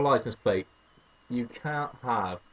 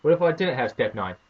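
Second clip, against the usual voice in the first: ordinary delivery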